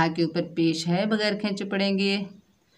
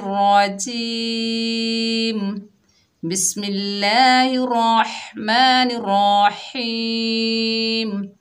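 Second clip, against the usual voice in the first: slow, raised, melodic recitation; Quranic recitation; 3 semitones higher, 3 dB louder, much slower than usual. Shown as a demonstration. Teacher opens the class with slow melodic recitation. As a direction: chant it slowly and reverently. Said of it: "तिलावत से करते हैं".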